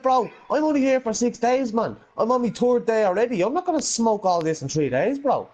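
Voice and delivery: mock exasperated protest; retold protest. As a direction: protest you can't smoke it all. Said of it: "And I went".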